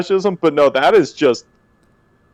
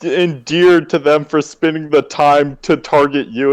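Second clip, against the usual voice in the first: normal talking voice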